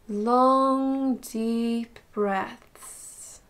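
soft, unhurried meditation voice with long pauses; guided meditation. Acted. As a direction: gently guide them through a body scan, slowly and softly. Leads body scan meditation.

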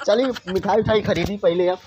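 ordinary talk, nothing marked

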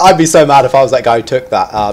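normal talking voice

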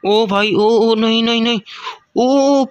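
high, drawn-out cries of disbelief; real high-pitched gamer astonishment; alarmed excitement. Real, not acted. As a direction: yelp as the fight swings suddenly. Alarm at the climax of fight.

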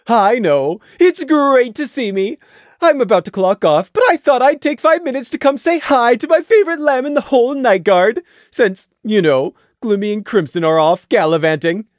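breezy, self-pleased chatter; cheerful Taffy. A narrator's reading. Narrator voices cheerful Taffy.

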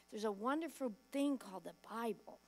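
ordinary talk

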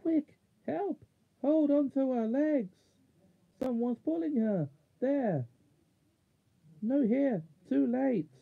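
high-pitched, urgent gasped cries; gasping urgency. A narrator's reading. Marsh wiggle cries for help as Jill is pulled away, gasping. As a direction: gasp out a desperate alarm, pitched right up. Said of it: "the voice of the marsh wiggle gasping".

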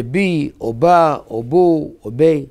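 בי, בה, בו, בי as bee, bah, boo, bay, each vowel held; vowel demonstration. Shown as a demonstration. Teacher showing how vowels voice the letters, demonstrating each syllable distinctly. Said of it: "אי אפשר לבטא את האותיות בלי שתי הניקוד".

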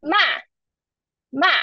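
MA falling from high, slightly longer; fourth tone. Shown as a demonstration. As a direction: drop MA firmly, giving it some length. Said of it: "So for fourth tone".

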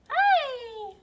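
high, loud sing-song hi. Real, not acted. Greeting baby brightly.

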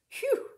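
puffing phew, very high; high winded phew. Acted. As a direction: blow out a breathless phew. Winded woman after climbing stairs.

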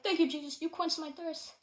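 high, loud joyful thanks; retold joyful prayer of thanks; rejoicing thanks.

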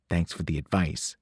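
quiet, icy thanks; cold reply. Acted. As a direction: shut him down coolly. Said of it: "she said coldly".

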